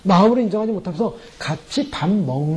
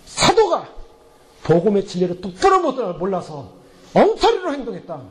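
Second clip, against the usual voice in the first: acted public scolding. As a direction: act out rebuking someone in front of everyone. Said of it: "그들에서 혼내는 거예요".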